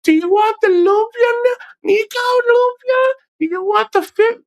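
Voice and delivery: high falsetto imitation of a woman offering food; high mock female voice. Mocking a woman offering him lumpia, high falsetto.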